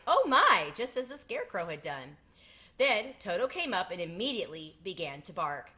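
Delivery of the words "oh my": higher and louder exclamation of wonder; cried out. Acted. Dorothy looking over the wall, crying out higher and louder. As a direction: cry it out in amazement. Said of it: "and cried".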